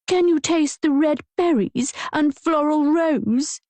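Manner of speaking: high-pitched posh imitation of him, slow and hammed-up; mocking the wine snob's posh voice; high posh mockery. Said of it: "my voice a high-pitched posh approximation".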